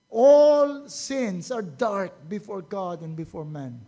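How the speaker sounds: loud, slow, every word weighed; slow emphatic declaration. Real, not acted. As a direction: preach it slowly and firmly. (mabagal at mariing pahayag)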